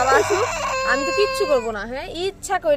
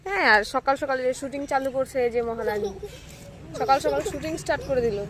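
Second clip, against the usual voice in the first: ordinary delivery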